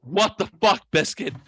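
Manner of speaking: loud, high protest from the husband; indignant. Retold or imitated. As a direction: retell an exasperated yelp. Husband's retold indignant reaction.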